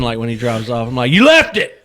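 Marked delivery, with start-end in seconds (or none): 1.1-1.8 high, raised holler; retold yell. Shopper yells at driver as he drives off, high retold yell. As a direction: yell it after a departing car. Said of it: "I yell at him again".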